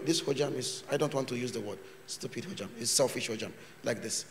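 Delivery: normal talking voice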